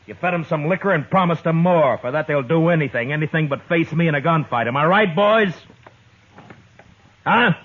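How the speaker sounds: building scorn that ends in a challenge; angry scolding. Acted. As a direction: call out the bluff in front of his men. Dillon facing down Carter's gang.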